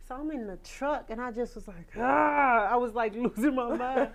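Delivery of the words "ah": loud burst of frustration; frustrated yell. Retold or imitated. Frustrated singer alone in her truck, loud yell. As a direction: let out an exasperated ah.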